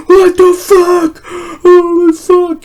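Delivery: very high, loud, drawn-out panic; mock horrified shriek. Horrified witness to the stabbing, high mock shriek. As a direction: squeal it slowly in imitated terror.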